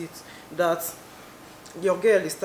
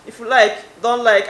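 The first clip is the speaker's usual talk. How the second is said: loud defiant "If you like, don't like."; loud sarcastic shrug-off; 3 semitones higher, 9 dB louder than usual. Acted. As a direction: fling it out, mock dismissive. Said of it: "I was only being sarcastic".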